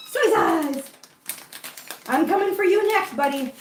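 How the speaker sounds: high excited pet voice; overwhelmed by cuteness. Real, not acted. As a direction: call to the kitten eagerly. Greeting the next kitten.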